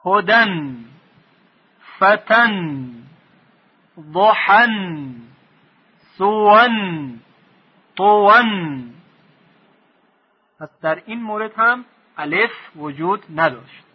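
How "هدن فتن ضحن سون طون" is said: teacher reciting tanwin words; recitation drill. Shown as a demonstration. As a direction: drill each word slowly with nasal -an ending.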